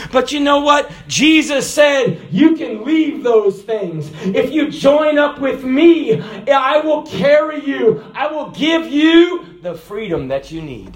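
slow, loud, high preaching; slow fervent preacher quoting Jesus. Acted. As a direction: lean into each promise with force.

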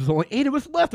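high-pitched excited news; acting out thrilled disbelief. Dancer tells Danny who is left, excited. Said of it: "they're jumping up excited".

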